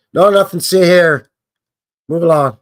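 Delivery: loud mock gatekeeper; mock official. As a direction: shoo them off like a guard hiding something. Mock official shooing people away.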